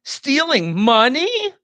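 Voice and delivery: "Stealing money?" shot up very high, loud and slow; exclamation of horror. Shown as a demonstration. Teacher voicing the exclamation mark, high, loud and slow. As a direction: cry out "Stealing money?" in outraged disbelief. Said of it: "as if I had written".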